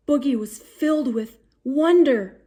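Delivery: plain reading voice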